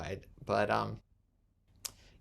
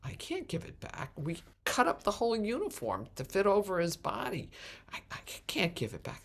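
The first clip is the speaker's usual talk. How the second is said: hushed; 7 semitones higher than usual. Retold or imitated. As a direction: whisper the bad news urgently. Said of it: "Funeral director goes".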